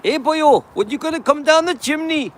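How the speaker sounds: high, loud sing-song Welsh Santa; Welsh accent. Acted. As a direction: put on a playful, exaggerated Welsh accent. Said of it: "for the Santa to have a Welsh accent".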